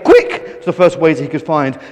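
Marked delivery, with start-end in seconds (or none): 0.0-0.6 shout. A narrator's reading. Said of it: "ran into the restaurant and shouted".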